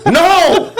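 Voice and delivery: the dying father snapping back in hammed-up anger; angry no. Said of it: "Like, angrily".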